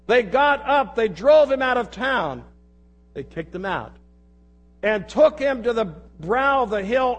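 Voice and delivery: projected, declamatory reading from the pulpit; forceful scripture reading. Real, not acted. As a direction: read the passage out to a whole congregation. Reads scripture aloud with force.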